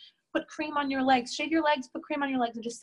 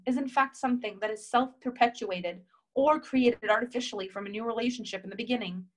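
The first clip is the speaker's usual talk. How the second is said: ordinary talk, nothing marked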